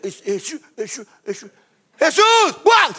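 faint high calls building to a loud cry; girl crying out. Retold or imitated. Preacher acts the freed girl finally calling Jesus, faint then shouting. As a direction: start faint, then burst out on the last Jesús. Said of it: "hasta que ella dijo".

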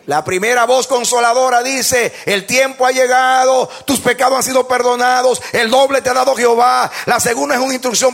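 high, loud pulpit proclamation compared with plain talk; predicación enérgica. Real, not acted. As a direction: proclaim each phrase with full force. Preaches at full force. (prédica a toda voz)

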